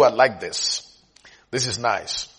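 ordinary talk

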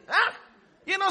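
usual speaking voice

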